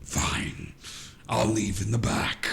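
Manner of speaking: eerie, voiceless whisper; whispered reply. Acted. Pyre answers with her mouth not moving, whispered. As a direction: whisper it with hardly any tone.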